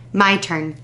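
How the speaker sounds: usual speaking voice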